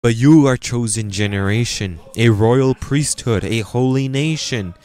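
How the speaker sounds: rising sermon declamation; preaching. Acted. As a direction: proclaim it to the congregation. Preaching to congregation.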